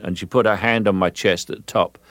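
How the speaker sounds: normal talking voice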